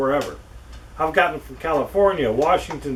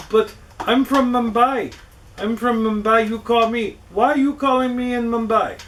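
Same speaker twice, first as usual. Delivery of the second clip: higher-pitched put-on Indian accent, teasing the scam caller; fake Indian accent; 7 semitones higher than usual. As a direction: pretend to be from Mumbai in a mock Indian accent. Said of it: "why the hell I'm talking like that".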